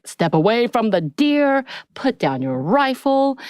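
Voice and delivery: imitating someone ordering others back; retold standoff command. Retells Ellie's armed standoff warning.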